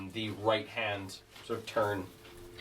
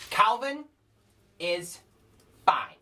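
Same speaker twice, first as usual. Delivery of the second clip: put-on proud little kid; 11 semitones higher, 5 dB louder, slower than usual. GM voices a small proud child.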